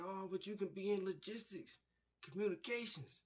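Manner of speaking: high, wheedling sales voice; mocking recruiter. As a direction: mimic the recruiter's pitch.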